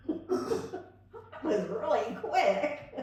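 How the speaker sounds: high, drawn-out words through laughter; amused and breaking into laughter; laughing. Real, not acted. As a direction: laugh your way through the line. Laughing at own story.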